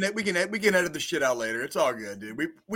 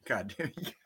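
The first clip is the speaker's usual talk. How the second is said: whispering; 12 dB quieter than usual. Real, not acted. Whispered laughing aside.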